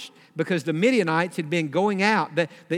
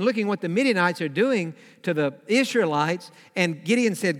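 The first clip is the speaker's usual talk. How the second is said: ordinary talk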